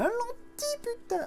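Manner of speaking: very high, thrilled squeak of joy; excited squeal. Real, not acted. Collector squealing over a lenticular, high thrilled squeak. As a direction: squeal with delight at the find.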